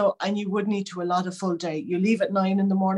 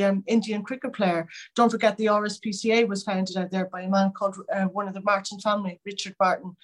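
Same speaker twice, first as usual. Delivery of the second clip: usual speaking voice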